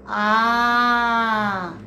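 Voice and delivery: long, loud "A" on one note; extended vowel. Shown as a demonstration. Teacher showing how words begin, held "A". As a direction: sustain a single "A" sound. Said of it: "coisas que iniciam com".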